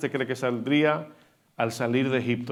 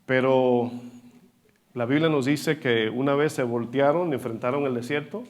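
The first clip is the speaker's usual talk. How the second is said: normal talking voice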